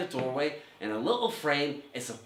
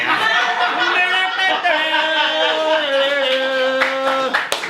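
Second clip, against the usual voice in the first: laughing; 14 semitones higher, 11 dB louder than usual. Real, not acted. Big laugh at the end.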